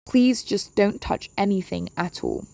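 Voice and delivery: usual speaking voice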